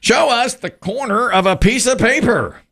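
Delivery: high-pitched host's call; suspenseful reveal announcement; game show reveal call. Real, not acted. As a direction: call it up to the board, dropping at the end. Game show host calls for the board.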